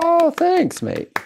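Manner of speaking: slipping into English accent; thanks with an English twang. Real, not acted. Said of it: "Mate, you went almost English then".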